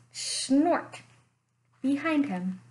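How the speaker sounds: ordinary delivery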